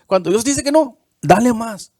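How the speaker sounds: loud, high, fervent preaching; fiery pulpit preaching; preaching at full force. Acted.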